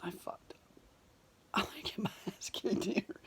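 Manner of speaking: whispered, breathy dread with a nervous laugh; panicked whisper. Retold or imitated. Man in an angry crowd whispers his panic to himself. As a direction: hiss it to yourself, realizing you blew it. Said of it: "And I was like".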